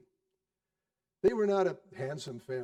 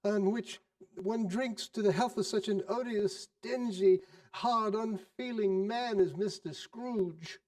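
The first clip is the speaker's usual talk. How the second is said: indignant wife; 5 semitones higher than usual. Acted. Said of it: "said she".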